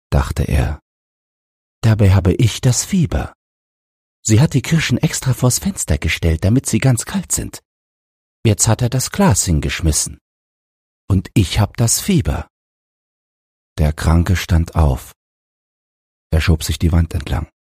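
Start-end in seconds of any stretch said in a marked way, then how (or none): 1.8-12.5 higher-pitched, aggrieved feverish patient; sick son fretting inwardly. A narrator's reading. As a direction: complain to yourself as the sick boy. Sick boy's thoughts in a story. (kranker Junge, vorwurfsvoll und hoch)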